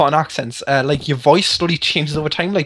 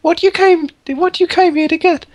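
much higher woman's voice, pitched up; Catherine woman voice; 9 semitones higher than usual. Acted. Gamer reading the trapped woman's lines to friends, high woman voice. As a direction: play a pleading imprisoned woman, pitched high. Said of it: "I've got to put my woman voice now".

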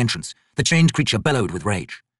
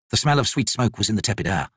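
plain reading voice

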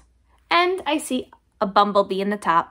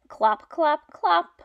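clop clop clop pitched up; clopping hooves; 5 semitones higher, slower than usual. Shown as a demonstration. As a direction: trot the clops out higher. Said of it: "He can sound like horse feet".

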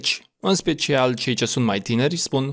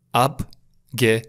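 "Abgelenkt" very slow, split ab-ge-lenkt; syllable by syllable; much slower than usual. Shown as a demonstration. Teacher sounding out a new word, slow syllables in "Abgelenkt". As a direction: break "Abgelenkt" into three separate syllables.

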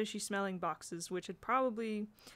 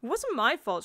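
loud, higher, indignant protest; spirited; 5 semitones higher, 8 dB louder than usual. A narrator's reading. Jane defending herself to the boy, spirited. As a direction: stand up for yourself with spirit. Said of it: "she said with some spirit".

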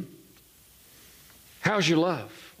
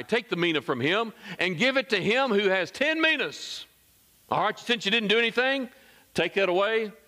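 higher-pitched acted command; stern order; 9 semitones higher than usual. Master to those who stood by, stern command. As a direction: order it taken off him, pitch raised.

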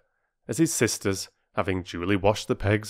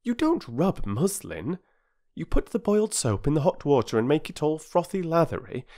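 high, careful big-sister voice; instructing girl, shocked; 8 semitones higher than usual. Acted. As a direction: explain earnestly as the girl who knows better. Voices Bobby correcting her sister.

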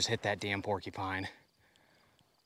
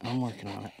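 whispering; 2 semitones higher than usual. Real, not acted. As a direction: whisper to calm the dog. Whispered take to dog.

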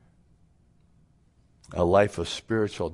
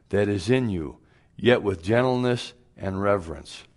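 scripture reading; 2 semitones higher, 4 dB louder than usual. Acted. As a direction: read the verse out with weight.

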